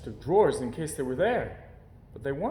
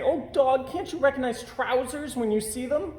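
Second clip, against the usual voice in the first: higher, exasperated bear voice; scolding the dog gently; exasperated little bear; 12 semitones higher than usual. A narrator's reading.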